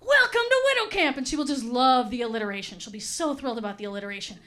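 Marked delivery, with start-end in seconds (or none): 0.0-1.2 much higher, chirpy welcome; perky counselor. Retold or imitated. Perky counselor greeting the widows in a high chirpy voice. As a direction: gush like an overeager camp counselor. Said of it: "perky camp counselor will be like".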